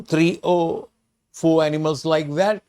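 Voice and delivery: ordinary delivery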